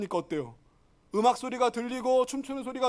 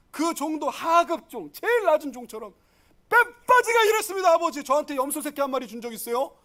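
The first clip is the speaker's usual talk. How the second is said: high, heated outburst from a resentful son; angry complaint; 3 semitones higher, 3 dB louder than usual. Acted. Elder son complaining to his father, fuming. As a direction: burst out loud on the hard labour. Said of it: "막 승질내고 화를 내고 있는 거예요".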